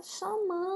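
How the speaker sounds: MAN of chamando stretched long; calling out. Shown as a demonstration. Teacher explains how to find stress, chamando drawn out like a call. Illustrates calling while saying it.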